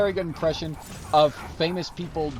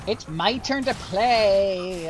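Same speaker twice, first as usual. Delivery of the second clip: put-on whiny kid; 5 semitones higher, 5 dB louder, much slower than usual. Streamer whines about turn.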